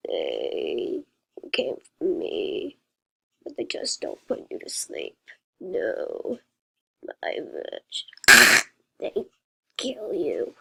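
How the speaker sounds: very low rasping whisper; creepy whisper. A narrator's reading. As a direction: growl it low like a creature. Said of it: "disturbing robot".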